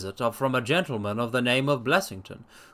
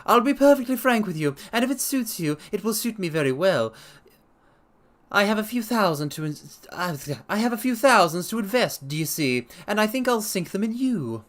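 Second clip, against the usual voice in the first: Blessington voiced; 6 semitones higher than usual. A narrator's reading. Blessington probing the doctor's habits, high sharp voice. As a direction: poke at him, high and sharp. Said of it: "Blessington continues".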